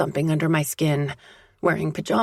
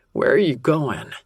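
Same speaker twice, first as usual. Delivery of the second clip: a gruff man sounding seductive; seductive man's voice. A narrator's reading. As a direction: lure her back in a gruff voice. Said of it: "The seductive sound".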